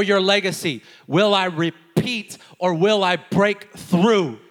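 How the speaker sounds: loud, high preaching at full force. Acted. Pastor urging the congregation, preaching at full force. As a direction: drive it home to the congregation.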